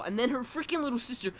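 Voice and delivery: ordinary delivery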